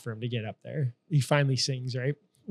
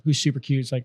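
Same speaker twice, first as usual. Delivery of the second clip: normal talking voice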